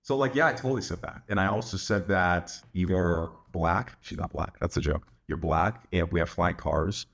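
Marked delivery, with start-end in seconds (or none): 0.0-4.0 low, deadpan mock agreement; unbothered, slowing sarcastic admission; going along. Shown as a demonstration. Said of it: "then you go along with it". 5.3-7.1 low, deadpan mock confession; dry, unbothered agreement; going along. Shown as a demonstration. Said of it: "then you go along with it".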